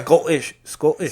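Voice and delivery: trying out a Scottish accent; tentative mock-Scottish accent; attempted Scottish accent. Shown as a demonstration. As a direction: test a rough Scottish pronunciation. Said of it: "now I wanna do British".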